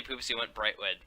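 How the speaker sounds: ordinary delivery, nothing marked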